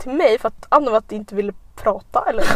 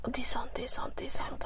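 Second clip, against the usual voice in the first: voiceless sheepish whisper; 17 dB quieter than usual. Retold or imitated. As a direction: concede in a whisper. Drunk friend concedes.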